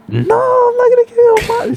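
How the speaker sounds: high, loud yelling grandma; retold yelling. Grandma yelling at the driver who wants water, high and loud. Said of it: "She's literally yelling in Spanish".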